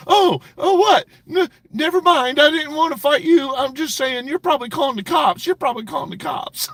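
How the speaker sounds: high, loud flustered mimic; mock backpedaling. Streamer mocks rival backing off a fight. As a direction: squeal it like a coward backing down. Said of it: "He's like".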